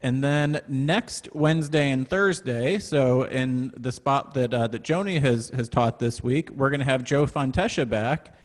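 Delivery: ordinary talk, nothing marked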